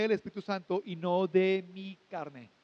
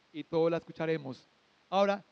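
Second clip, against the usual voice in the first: ordinary talk, nothing marked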